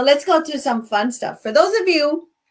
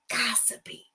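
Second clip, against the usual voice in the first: ordinary talk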